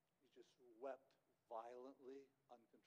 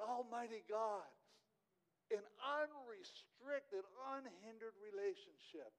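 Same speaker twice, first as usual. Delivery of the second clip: higher and louder, fervent sermon build; full-force preaching; 7 semitones higher, 7 dB louder than usual. Acted. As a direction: preach it out to the whole room.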